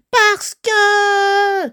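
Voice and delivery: loud, high monster yell; howled retort. Acted. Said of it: "hurla Titi de plus belle". (hurlement aigu et fort)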